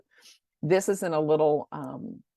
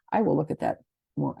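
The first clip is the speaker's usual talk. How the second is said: normal talking voice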